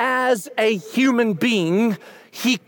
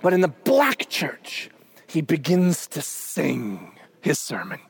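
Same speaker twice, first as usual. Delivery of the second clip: hushed build-up; 7 semitones lower, 4 dB quieter than usual. Shown as a demonstration. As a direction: drop right down and stretch it reverently. Describing sung sermon, slows and drops.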